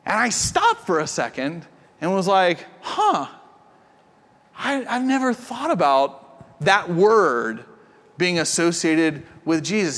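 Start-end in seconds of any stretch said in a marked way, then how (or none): 2.8-10.0 slow, thoughtful, surprised; slow wondering; musing. Retold or imitated. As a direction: think it over aloud. Pondering in story.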